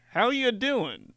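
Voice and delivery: lightly voiced perfect-guy dad; LinkedIn dad. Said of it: "he's like".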